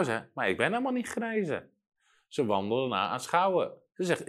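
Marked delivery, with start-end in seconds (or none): 0.3-1.7 quick, high-pitched mock objection; imitated protest. Woman objects to the preacher's instruction, imitated high protest. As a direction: voice a doubting woman, high and hurried.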